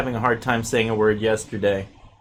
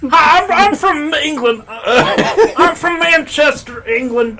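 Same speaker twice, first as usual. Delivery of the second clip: very high, loud mock English voice; bad English accent; 7 dB louder, slower than usual. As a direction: badly fake a Manchester, England accent, drawn out. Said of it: "That's your English accent?".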